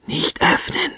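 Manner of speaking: breathless, voiceless whisper. A narrator's reading. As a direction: whisper a frightened warning. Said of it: "flüsterte Oma Krempel".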